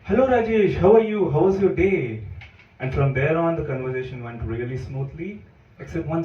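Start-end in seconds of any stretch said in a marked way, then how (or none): 0.0-2.2 higher, louder chirpy greeting as the date; a woman greeting her date brightly, voiced a bit higher; date's voice. Date greeting him as Rajesh, higher and louder. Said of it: "and she said".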